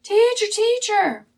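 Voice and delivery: high, drawn-out frantic shout; crying out. Acted. Disciples trying to reach Jesus in the storm, crying out. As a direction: call over a roaring wind, loud and stretched. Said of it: "crying out over the winds".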